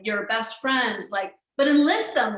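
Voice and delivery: normal talking voice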